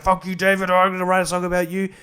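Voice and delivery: a hint of a petty rapper firing back. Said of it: "[name]'s listening to us being like".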